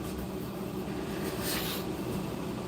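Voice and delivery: soft, wheezy laughing fit; real laugh. Real, not acted. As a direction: crack up and let it fade out. Cracks up at own story.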